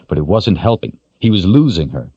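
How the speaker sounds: plain reading voice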